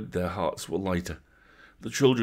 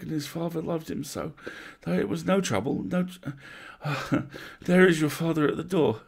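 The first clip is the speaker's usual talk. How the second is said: wavering voice; 10 semitones higher than usual. Acted. Mother's voice wavers, then brightens.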